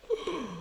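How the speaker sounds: soft, high intake of breath; mock shocked gasp. Describing a show that goes places, small acted gasp. Acts viewer's shocked reaction.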